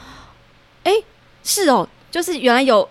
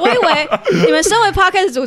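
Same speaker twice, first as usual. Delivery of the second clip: laughing while teasing; 8 semitones higher, 4 dB louder than usual. Real, not acted. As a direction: say it through laughter. (笑着调侃)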